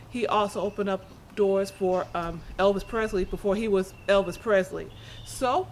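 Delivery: usual speaking voice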